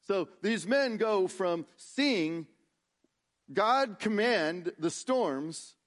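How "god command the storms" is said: ordinary delivery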